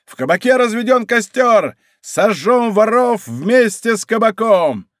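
high, ringing mob cries; crowd roaring. A narrator's reading. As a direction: yell it out to incite. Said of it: "ревела толпа".

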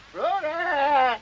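roepen naar de geit; calling out. Acted. Calling the goat. (luid roepend naar de geit Flora)

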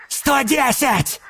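high excited call; eager bid shout. Acted. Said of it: "азартно выкрикнул Фоса".

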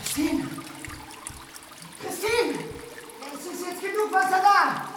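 loud, high calling out in the flooded room; urgent shout. Acted. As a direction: call for her with rising urgency.

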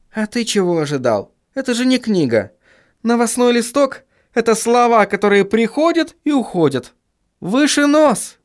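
high, lively woman cheering him up; encouraging female character. Acted.

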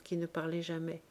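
ordinary delivery

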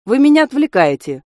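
sharp, high-pitched telling-off; curt rebuke. A narrator's reading. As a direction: snap at them for distracting you. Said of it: "отрезал смотритель".

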